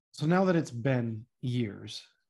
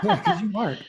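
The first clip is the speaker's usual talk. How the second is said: higher, louder, through real laughter; laughing; 8 semitones higher, 6 dB louder than usual. Real, not acted. Host reassuring guests they aren't cursed, laughing. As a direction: agree while laughing.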